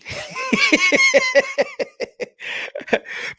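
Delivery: long, squealing high laugh; helpless high cackle; high-pitched laugh. Real, not acted. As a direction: burst into a high, drawn-out giggle. Laughs after his accent bit.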